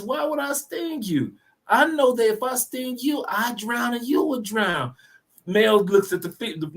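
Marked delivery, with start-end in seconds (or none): 0.0-4.9 female scorpion. Acted. Female scorpion talking the male frog round, high feminine voice. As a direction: play a she-scorpion reasoning sweetly up high. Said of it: "She says".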